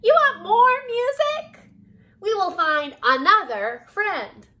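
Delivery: loud, very high cricket voice; high-pitched, booming little cricket; eager, oblivious cricket. A narrator's reading. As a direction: squeal it helpfully, stretching the last words. Cricket misunderstands.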